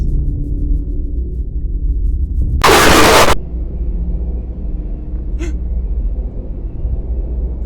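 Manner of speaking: long high breathless gasps; startled gasping at a jump scare; frightened gasp. Real, not acted. Jump scare in horror game.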